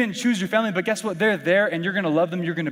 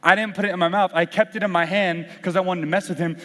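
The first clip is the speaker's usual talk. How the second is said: usual speaking voice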